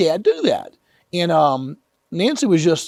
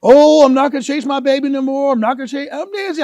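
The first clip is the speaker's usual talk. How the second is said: high whiny child's wail, hammed-up; mock crying child; 8 semitones higher, 4 dB louder than usual. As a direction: wail like a hurt little boy. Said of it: "I start crying".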